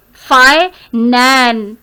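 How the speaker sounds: "fi-nan" split, with "nan" held long; lengthened NAN. Shown as a demonstration. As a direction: break after "fi" and stretch "nan". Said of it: "आपने उसको लंबा किया". ("fi-nan" में "nan" को लंबा खींचकर)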